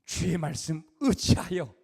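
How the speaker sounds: slow, reverent "주의 말씀 의지하여" with weight on "주의"; slow, solemn vow of obedience; solemn Peter. Acted. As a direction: speak "주의 말씀 의지하여" slowly like a humbled vow. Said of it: "그래서 무슨 말이 나오냐?".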